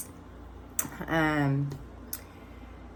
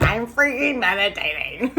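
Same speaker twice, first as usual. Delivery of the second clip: laughing, louder, higher comeback; half-laughing proud answer; laughing retort; 6 semitones higher, 5 dB louder than usual. Retold or imitated. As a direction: toss it back with a laugh.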